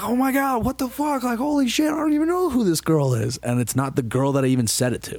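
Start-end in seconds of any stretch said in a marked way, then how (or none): 0.0-3.4 very high, frantic exclamations; panicked disbelief. Retold or imitated. Bewildered guy while a stranger swings at him, panicked. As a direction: squeal the bewilderment all through.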